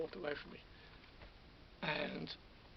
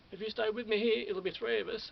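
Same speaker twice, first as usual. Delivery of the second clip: louder, higher call after them; 6 semitones higher, 7 dB louder than usual. Retold or imitated. Protester calling after the passers-by who walk off, raised voice. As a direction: call out to people walking away.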